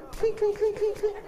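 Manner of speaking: very high, rapid clinks; glass clinking imitation. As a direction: squeak out a run of tiny clinks. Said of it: "you hear the glass".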